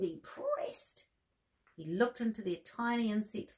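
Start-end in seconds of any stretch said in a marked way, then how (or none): 0.0-1.0 squeezed out high, breathy strain; mock strain. A narrator's reading. Walter pushing to fart, strained high voice. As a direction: strain the words like pushing hard.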